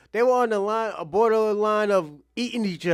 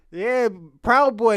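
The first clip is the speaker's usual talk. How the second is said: ordinary delivery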